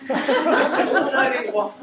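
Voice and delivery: laughing triumphant gloat; gleeful triumph. Acted. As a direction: savour the victory, stretch it out. Triumph after the call.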